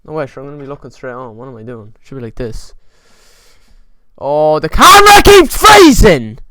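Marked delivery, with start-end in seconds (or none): 4.2-6.4 loud, high, exasperated shouting at the camera; real annoyed shout at a frozen camera; frustrated yelling. Real, not acted. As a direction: lose it at the freezing camera, slowly and loud. Camera keeps freezing, frustrated outburst.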